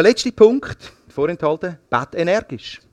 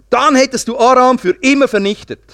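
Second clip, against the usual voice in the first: raised, forceful rebuke read aloud; angry prophet; 6 semitones higher, 4 dB louder than usual. A narrator's reading. Prophet Elisa rebukes the king for stopping too soon, mildly angry. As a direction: scold with mild anger, pitched up. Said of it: "wurde der Mann Gottes sehr zornig".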